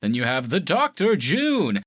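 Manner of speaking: talk show announcer. Retold or imitated. Podcaster imitating a talk show announcer listing the guests, slow high hype. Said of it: "talk show gimmicks".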